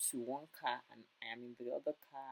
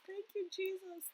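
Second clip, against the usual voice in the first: high thanks. Real, not acted. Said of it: "I was so, so emotional".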